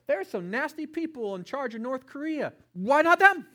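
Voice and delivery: indignant complaint rising to a shout; loud protest. Retold or imitated. Husband bargaining with God on the mountain, building to a shout. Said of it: "I asked God loudly, repeatedly".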